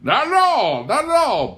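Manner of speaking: high, loud, jovial boom; hearty farmer waving off the question. Acted. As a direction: bellow it out with good cheer. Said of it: "boomed Pa".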